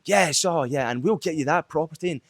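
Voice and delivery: a hammed-up cheesy salesman pitch; salesy game show host. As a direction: do an over-the-top game show host selling a property. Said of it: "they almost sound like a game show host".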